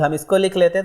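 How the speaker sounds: ordinary talk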